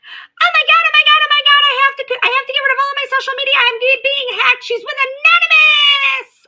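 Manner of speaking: very high, loud, frantic mock shriek; imitated panicked screaming. Vlogger imitating Chocolate Sunrise screaming about being hacked, very high and loud. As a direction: shriek it like she's losing her mind. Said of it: "And she starts screaming".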